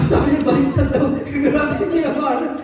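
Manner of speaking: talking through loud laughter; laughing loudly at a congregant's joke. Real, not acted. Preacher laughs at a congregant's reply.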